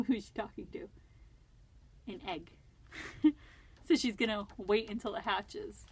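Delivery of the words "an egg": ordinary talk, nothing marked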